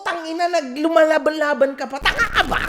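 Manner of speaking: loud, high exasperated yelling; angry rant. Acted. Host yelling at Vince for giving up, loud and high. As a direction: blow up at him in disbelief. Ranting at Vince about quitting.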